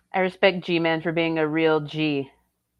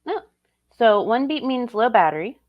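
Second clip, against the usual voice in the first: normal talking voice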